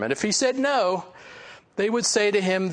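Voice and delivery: ordinary talk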